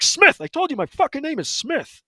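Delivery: high, shrill-pitched yell of the name; mock furious yell of his name; retold shout. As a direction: shout it at her, losing patience. Yelling name at hairdresser.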